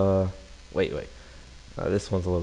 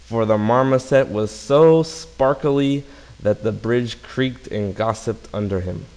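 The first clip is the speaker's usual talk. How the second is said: ordinary delivery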